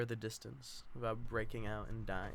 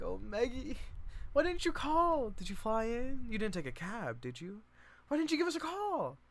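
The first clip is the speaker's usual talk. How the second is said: much higher, squealing questions; excited reunion; 10 semitones higher than usual. Acted. Lenny greeting her sister Meg, high excited gushing. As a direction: gush over her arrival.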